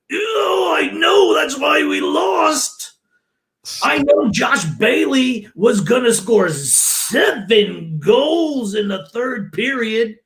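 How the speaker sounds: high, slow, shouted sarcasm; sarcastic mock. Co-host mocks the coach's excuse, high sarcastic shout. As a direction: drag it out, way up high, dripping mockery.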